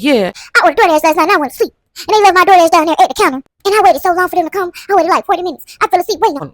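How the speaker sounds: high, fast, put-on voice for the complaining friend; retelling the friend's DoorDash complaint in a high, fast mock voice; imitating her friend. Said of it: "She was like".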